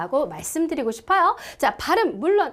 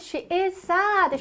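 "sad" held long and falling; slow model sentence; 4 semitones higher, much slower than usual. Shown as a demonstration. Teacher drilling the sentence, very slow. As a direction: say "she is sad" very slowly.

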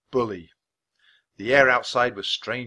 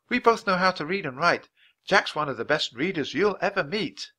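put-on higher-pitched girl proud of her companion; eager young girl; 7 semitones higher, 3 dB louder than usual. Girl telling the teacher about Jack, brightly eager. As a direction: talk up a friend to the teacher.

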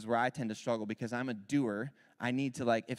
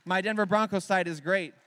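louder, higher bragging about my Broncos site; loud proud boast; 6 semitones higher, 8 dB louder than usual. Real, not acted. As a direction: boast it loudly with pride. Proud boast after jab.

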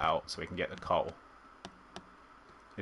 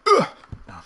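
startled cry; 10 dB louder than usual. Real, not acted. Sudden shock in the game.

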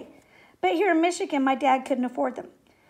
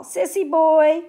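loud, drawn-out playground taunt; jeering call; 3 semitones higher, 9 dB louder, slower than usual. A narrator's reading. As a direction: stretch it out and call it down the hall. Kids taunting Tom in the hall.

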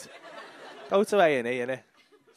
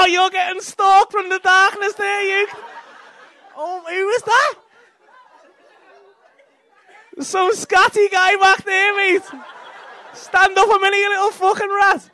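higher, louder, slowed, half laughing; loud call-out; 11 semitones higher, 8 dB louder than usual. Real, not acted. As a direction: shout it into the dark at the back. Shouting toward heckler in the dark.

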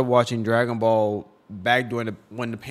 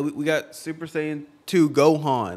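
ordinary talk

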